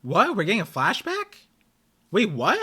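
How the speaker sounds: startled, rising what; surprised. Real, not acted. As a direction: caught off guard. Sudden flashback surprises viewer.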